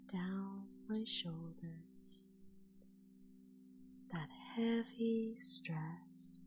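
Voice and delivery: slow, soft whispered meditation; whispered guided relaxation. Acted. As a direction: guide the listener into sleep, slowly.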